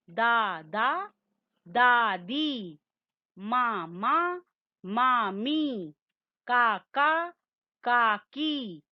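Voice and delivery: very slow word list from "दादा" to "भतीजी", every long vowel held; long vowel demo. Shown as a demonstration. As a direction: stretch each syllable for learners to copy, as in "दा-दी". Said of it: "उसका उच्चारण थोड़ा ज्यादा होगा".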